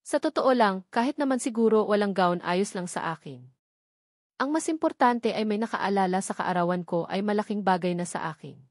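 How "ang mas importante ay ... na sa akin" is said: ordinary delivery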